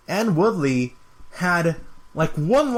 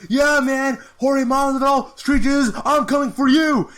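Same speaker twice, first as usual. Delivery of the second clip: much higher, louder hammed-up challenge; imitating Colby; 10 semitones higher, 4 dB louder than usual. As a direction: call him out like a hyped brawler. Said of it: "he's like".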